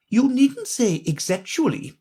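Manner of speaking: high correcting Queen voice; Queen correcting Alice's word; Queen remarking. A narrator's reading. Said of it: "the Queen remarked".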